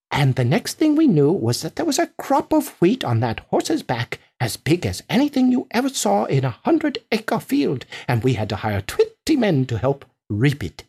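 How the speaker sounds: a hint of a boastful farmer; lightly voiced farmer boasting. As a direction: pile up the farmer's tall claims.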